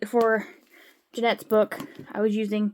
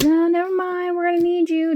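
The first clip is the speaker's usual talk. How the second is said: higher-pitched mock quote; put-on higher voice for a retold order; imitated boss; 9 semitones higher, 4 dB louder than usual. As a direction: mimic someone changing their mind, pitched up. Acting out the boss's quote.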